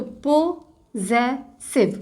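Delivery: Possessive stretched out very slowly, syllable by syllable; very slow sounding out. Shown as a demonstration. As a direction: draw out possessive for the class. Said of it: "word create करें जेस का". (Possessive को बहुत धीरे खींचकर बोलें)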